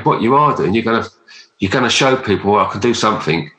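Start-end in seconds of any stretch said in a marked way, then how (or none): none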